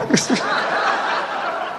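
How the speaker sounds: squeaky breathless giggle; high unvoiced laugh; high wheezy laugh. Real, not acted. Laughing after forgotten gag.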